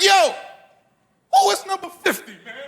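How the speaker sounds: high-pitched outraged father in the stands; wilding-out dads. Acted. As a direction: heckle like a riled-up football dad. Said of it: "they started wilding out".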